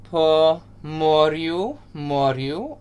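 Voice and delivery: "po moryu, moryu" slowly, with rolled R's; Ukrainian pronunciation. Shown as a demonstration. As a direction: model "moryu" slowly, rising at the end. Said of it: "So everyone say that".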